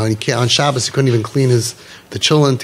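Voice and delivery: ordinary delivery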